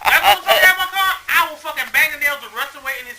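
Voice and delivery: cracking up mid-threat; laughing rant. Pretend. Mock-threatening the car thief, loud and laughing.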